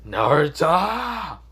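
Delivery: high, loud, stretched-out cry of a name; drawn-out yell. Acted. Sasuke yelling at Naruto who shushed him, drawn-out yell. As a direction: yell the name long, rising then falling.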